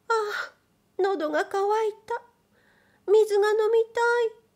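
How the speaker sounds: high, breathless princess voice, rushing the last words; a young noblewoman pleading for water, voice up high; thirsty princess. Acted. Princess in the carriage complaining to her attendants, high and hurried.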